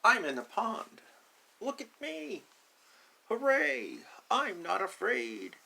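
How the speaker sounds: high, loud triumphant cheer; a little duck shouting hooray; excited duckling. Acted. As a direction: crow with pride from the water. Duckling triumphant after jump.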